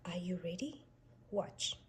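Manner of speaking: plain reading voice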